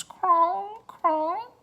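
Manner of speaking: high, ringing bird calls; raven sounds. Acted. Raven thanking Annie with high bell-like calls. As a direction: sing out like a bell-voiced raven. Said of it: "Rock made soft bell-like sounds".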